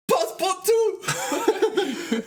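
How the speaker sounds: high, slow, hammed-up gentleman's call; Phileas Fogg calling. Narrator voicing his master calling for him. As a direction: sing out his name like a posh master summoning. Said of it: "All I know is he said".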